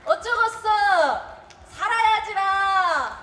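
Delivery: high, loud, drawn-out cry; rousing call. Acted. Performer closing with favorite Jeolla saying, loud drawn-out call. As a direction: call it out loud, stretching the last word. Favorite phrase called out as finale.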